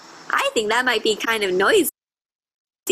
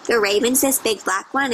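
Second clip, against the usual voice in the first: ordinary talk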